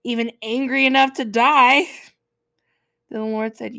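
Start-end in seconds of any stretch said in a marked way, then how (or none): 0.0-1.9 loud defiant shout; hammed-up furious Jonah; mock furious yes. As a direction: yell it back like a sulky teen. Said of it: "Jonah goes".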